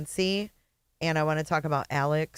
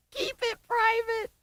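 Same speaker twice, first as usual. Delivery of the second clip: mock repeat. Host mockingly repeats the clip.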